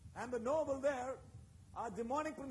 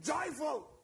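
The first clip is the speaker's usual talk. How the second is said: shout; 2 semitones higher, 6 dB louder than usual. Acted. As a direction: call out "Joyful" like a rally cry. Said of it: "the Bible is mentioning there and saying".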